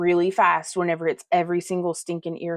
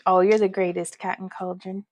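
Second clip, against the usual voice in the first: ordinary delivery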